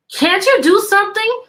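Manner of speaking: the bored queen begging the jester for help; pleading queen. Acted. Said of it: "asked Queen Sophie".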